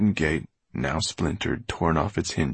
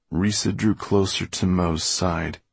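plain reading voice

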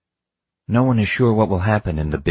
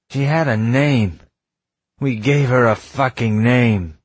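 slow, raised, grieving cry; anguished outburst; 6 semitones higher, 5 dB louder, slower than usual. Acted. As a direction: pour out the grief loudly.

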